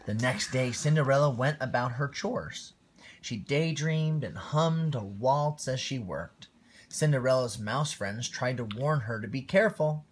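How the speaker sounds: lively read-aloud storytelling voice; storybook reading. A narrator's reading. Parent reading Cinderella to a small child, lively storytelling voice. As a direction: read it like a bedtime picture book.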